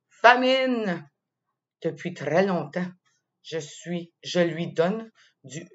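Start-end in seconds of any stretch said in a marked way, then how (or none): 0.1-1.1 higher, louder cry of hunger; stomach crying out. Acted. The hungry stomach cries to the child, playful loud cry. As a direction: call out like a starving belly. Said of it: "Mon estomac me crie".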